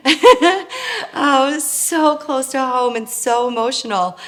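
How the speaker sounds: laughing through the words, slow and high; a real emotional laugh breaking into the line; high, slow laughter swallowing the sentence. Real, not acted.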